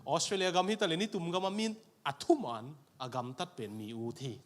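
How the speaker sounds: ordinary talk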